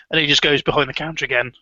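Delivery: ordinary talk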